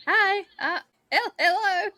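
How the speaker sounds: high, hesitant hi hello; awkward greeting. Retold or imitated. Mimicking him fumbling a hello to his wife. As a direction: stammer a shy hello way up high. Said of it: "And you're like".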